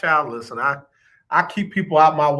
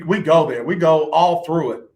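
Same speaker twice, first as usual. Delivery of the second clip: ordinary delivery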